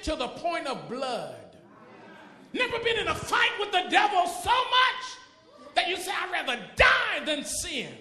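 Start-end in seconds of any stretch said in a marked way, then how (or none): 2.5-7.9 shouted declaration, pitched up; loud preaching. Acted. Pastor challenging believers who never died to sin, shouting. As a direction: cry out the vow.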